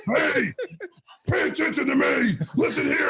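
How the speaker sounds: high, mock stern shout; stern coach. Coach Phil scolding his players, mock shout. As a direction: snap the players to attention. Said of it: "Phil would turn around".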